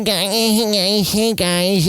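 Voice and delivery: high whiny nasal greeting; whiny Jay Baruchel voice. Retold or imitated. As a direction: whine out a high hey guys. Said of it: "he's got a whiny voice".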